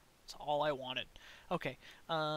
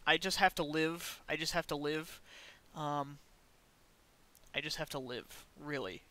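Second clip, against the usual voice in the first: normal talking voice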